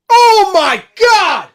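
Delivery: very high, loud, drawn-out yell; excited shout. Real, not acted. Reacting to a dangerous ball in, slow loud yell. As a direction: scream at a great cross.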